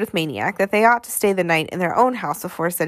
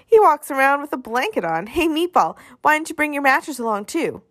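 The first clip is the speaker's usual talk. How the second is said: higher, mocking little boy; taunting kid voice; 6 semitones higher than usual. A narrator's reading. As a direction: tease him like a brat.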